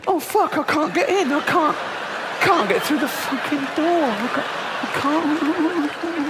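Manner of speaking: high, whining and straining at a door; whiny fat shopper. Retold or imitated. Fat shopper stuck at the cake door, high whining. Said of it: "They go".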